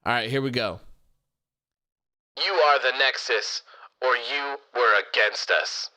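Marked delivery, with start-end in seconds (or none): 2.4-5.9 lightly put-on character voice, a bit slower; a hint of a hammed-up wrestling promo; Mart voice. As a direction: play the line as a mild character read. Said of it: "in the A O W Mart voice".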